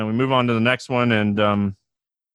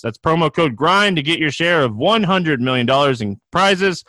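upbeat ad read, pitched up; booming prize announcer; 4 semitones higher than usual. Acted. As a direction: sell it like a radio spot. Reads a sponsor ad.

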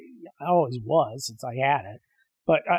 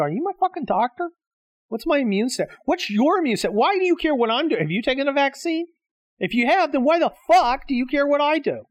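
higher, heated pretend rant at a stranger; imagined angry retort; 10 semitones higher than usual. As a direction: fire questions back, indignant and raised.

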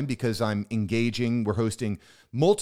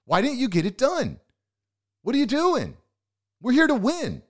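tough coach; 10 semitones higher than usual. Acted. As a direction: grill the listener like a no-excuses coach. Tough coach demanding results.

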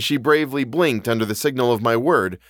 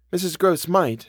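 usual speaking voice

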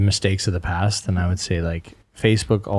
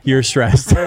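laughing; 8 semitones higher, 4 dB louder than usual. Real, not acted. Teasing the stressed bride.

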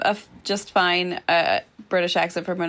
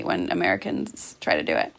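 ordinary talk

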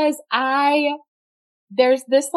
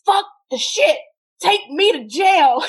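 high, loud yell, slow on take me to jail; mock defiant shout; 6 semitones higher, 3 dB louder than usual. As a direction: shout it like a fed-up arrestee giving up. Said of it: "he was like".

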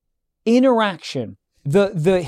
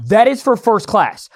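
slow, higher-pitched insistence; escalating insistence; 4 semitones higher, 3 dB louder than usual. Retold or imitated. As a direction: lay down the rule word by word. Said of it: "this is when it gets a little crazy".

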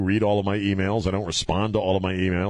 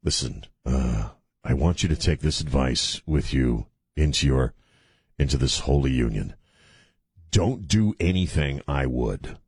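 wedding officiant; 8 semitones lower than usual. Acted. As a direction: give deadpan wedding advice, pitched down and measured. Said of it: "all you end up saying is".

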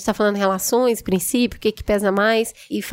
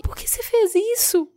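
hurt disbelief; 9 semitones higher than usual. Retold or imitated. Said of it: "E aí eu falei".

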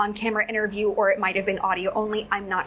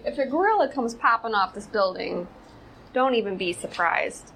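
usual speaking voice

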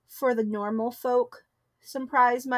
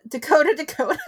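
laughing; 5 semitones higher, 6 dB louder, faster than usual. Real, not acted. Playing with rhymes, laughing.

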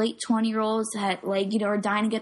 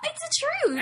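much higher playful squeal; mock protest. Teasing protest.

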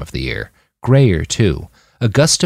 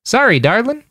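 much higher, falsely cheerful lilt; artificially chipper. A narrator's reading. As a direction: put on a sugary cheer that is obviously fake. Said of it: "in an artificially chipper voice".

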